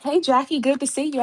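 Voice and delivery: ordinary talk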